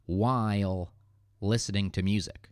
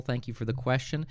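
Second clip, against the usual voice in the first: ordinary delivery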